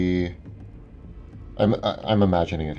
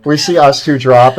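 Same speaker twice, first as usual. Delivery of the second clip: usual speaking voice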